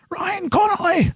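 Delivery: name flung out high and sudden; excited call-out. Real, not acted. Fan calling out the filmmaker's name, excited high call. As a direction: cheer his name like a fan.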